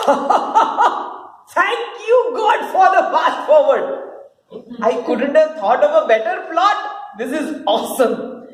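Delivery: high laughing gloat; scheming villain's glee; gleeful laugh. Acted. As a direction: cackle with delight at the plot.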